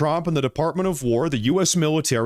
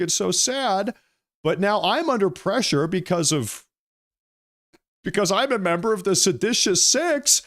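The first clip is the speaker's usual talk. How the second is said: mocking whiny voice; 8 semitones higher than usual. Commentator mocking the congresswoman's complaint in a high whiny voice. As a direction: mimic her as a self-pitying whiner, pitched way up. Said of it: "She says".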